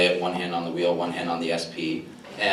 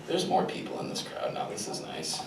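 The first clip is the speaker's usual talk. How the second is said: breathy unvoiced aside to himself; whispered aside; 10 semitones higher, 9 dB quieter than usual. Real, not acted. A comic on stage whispering an aside to himself. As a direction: murmur it under your breath, just for you.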